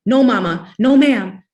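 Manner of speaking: high, loud, desperate pleading; children shrieking denials at the mother with the switch. A narrator's reading. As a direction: beg her not to whip you. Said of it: "We began to shriek".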